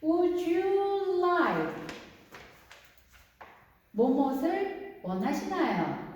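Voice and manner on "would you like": drawn-out, higher teacher demo; very slow classroom phrase; slow model phrase. Shown as a demonstration. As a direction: stretch each word for learners. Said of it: "그럴 때 뭐라고 얘기하죠?".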